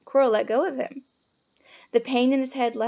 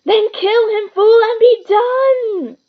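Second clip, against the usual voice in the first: high screeching rage; villain screeching kill order; screeched; 11 semitones higher, 10 dB louder, much slower than usual. A narrator's reading. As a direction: screech the command. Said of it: "screeched Voldemort".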